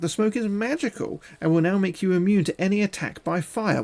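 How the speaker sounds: ordinary talk, nothing marked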